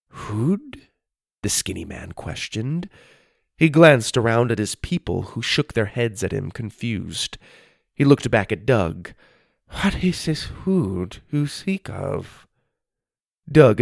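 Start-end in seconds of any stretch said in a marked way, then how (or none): 0.1-0.8 puzzled thin stranger. Acted. Strange man's voice. 9.8-12.5 slow puzzled stranger. Acted. Strange man's voice.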